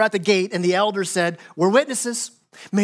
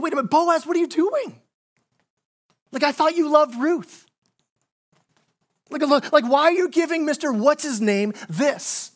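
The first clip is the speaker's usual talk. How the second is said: incredulous listener; 7 semitones higher than usual. Retold or imitated. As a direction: play a stunned listener questioning Boaz. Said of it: "you gotta be thinking".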